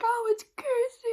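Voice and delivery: mock sobbing. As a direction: imitate him blubbering for his wife. Husband crying with relief.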